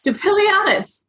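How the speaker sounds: high, ringing spell call; declared spell. A narrator's reading. Ginny casting a spell at Harry, declaring it high. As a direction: announce the spell like a witch. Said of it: "she declared".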